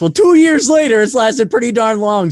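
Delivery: amused emphasis. Real, not acted. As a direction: burst out, amused at how long it lasted. Ironic surprise at podcast lasting.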